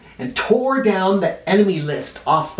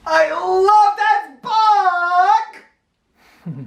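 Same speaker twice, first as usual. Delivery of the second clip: excited; 13 semitones higher, 3 dB louder, slower than usual. Real, not acted. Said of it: "enthusing about the book".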